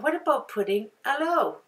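a hint of a British accent on "what about putting hello". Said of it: "like I'm British".

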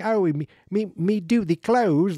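high, loud mock Cockney washerwoman; hammed-up Cockney squawk; Cockney accent. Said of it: "like a Cockney accent".

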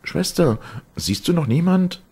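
high-pitched frightened cry for news; anxious calling out. A narrator's reading. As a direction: call out desperately to a sister in a high woman's voice. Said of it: "dazwischen rief sie".

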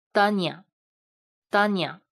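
dania said very slowly, closed a, mouth barely open; slow model reading. Shown as a demonstration. Teacher modeling "dania" slowly for students. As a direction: say "dania" slowly with a closed a, lips nearly shut. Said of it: "发的时候是这样的".

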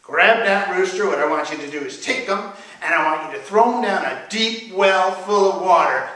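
loud, commanding order; forceful, emphatic storybook anger; angry Sultan. A narrator's reading. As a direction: order the servant about angrily. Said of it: "He was angry".

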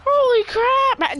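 high, loud and slow gasp; stunned by what you see; shocked exclamation. Real, not acted. Shocked by enemy squad.

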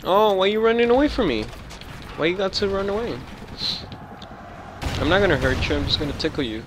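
high, singsong teasing taunt. Real, not acted. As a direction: tease the fleeing enemy playfully. Taunting a fleeing enemy.

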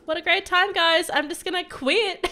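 loud, high, fed-up outburst; exasperated sarcasm. Real, not acted. Fed up, threatens quitting.

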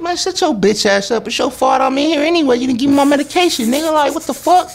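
yelled way up high, fast; high angry retort. Retold or imitated. Teller replaying cursing out the sheriff, high and angry. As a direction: tell him off high and fast.

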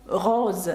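slow "роз" ending in a voiced Z; "роз" with a buzzing Z ending; S read as Z. Shown as a demonstration. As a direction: draw out French "роз" with a final Z. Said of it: "ця літера дає звук З".

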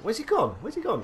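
high, panicky searching; frantic. Real, not acted. Pilot losing his target mid-dogfight, frantic high call. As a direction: scan the sky in a panic.

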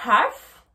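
HALF opened on an audible breath, silent L. Shown as a demonstration. English teacher showing how to pronounce H, breathy H on HALF. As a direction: puff out the H of HALF, "haaf". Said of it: "prendre sa respiration".

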